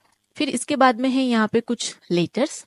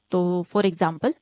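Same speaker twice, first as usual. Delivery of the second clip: ordinary talk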